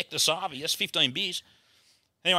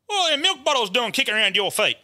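higher-pitched acted challenge; mate demanding answers; 7 semitones higher than usual. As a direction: play your mate calling you out. Bert challenges him in the story.

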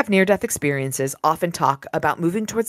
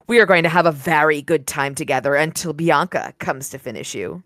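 low, even audiobook reading; story narration; 4 semitones lower than usual. A narrator's reading. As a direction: read the passage like a novel narrator. Reads the novel aloud.

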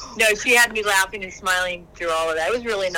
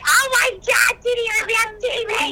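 imitating a friend's thrilled shriek; excited squeal; 12 semitones higher than usual. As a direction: shriek with delight at the TV. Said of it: "Kiki gets so excited".

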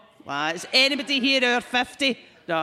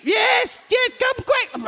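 put-on high, loud, slow old-woman shout; screeching old woman; 5 dB louder, much slower than usual. Old woman giving the fake address to the pest line, high and loud. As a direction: shriek the fake address like a frantic granny. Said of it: "pretending to be an old woman".

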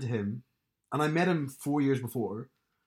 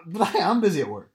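louder, higher-pitched insistence; emphatic protest; 7 semitones higher, 8 dB louder, faster than usual. Real, not acted. Podcaster teased about being busy at work, emphatic protest. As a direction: insist loudly in your own defence.

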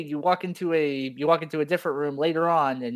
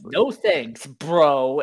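loud dismissal; 6 dB louder, much slower than usual. Retold or imitated. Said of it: "he's just like".

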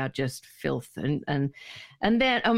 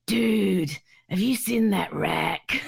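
slow, lowered bloke voice; mimicked Corey; much slower than usual. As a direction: do Corey eyeing her up. Impersonating Corey's crude remark.

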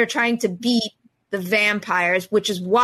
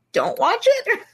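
squeaky high; 12 semitones higher than usual. Acted. Playful dismissive kicker.

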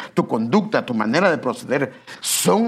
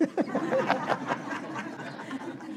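breathy laugh; 13 semitones higher, 10 dB quieter than usual. Real, not acted. Preacher laughs at his joke.

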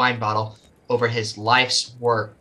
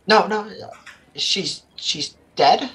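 higher, slow disbelieving cry; slow broken disbelief; stunned grief; 8 semitones higher, slower than usual. Acted. Connor learns of death.

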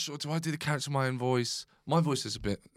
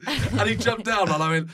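ordinary talk

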